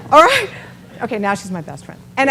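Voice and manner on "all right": loud, high, overwhelmed "all right". Retold or imitated. Moved speaker answers the praising woman, loud and high. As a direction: blurt it out, bowled over. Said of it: "I'm like".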